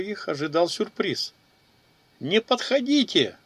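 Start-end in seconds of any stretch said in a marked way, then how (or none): 2.2-3.4 high, panicked cry to keep them back; a young woman's frightened shout; shouted warning. A narrator's reading. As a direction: yell at them to stay away, voice up high. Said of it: "заорала молодая женщина".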